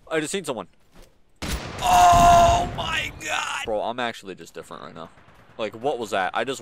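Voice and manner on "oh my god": very high, loud outburst, dropping in volume; astonished shout. Real, not acted. Gamer stunned by the play just made, astonished shout. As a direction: shriek it in real amazement. Shocked by own play in game.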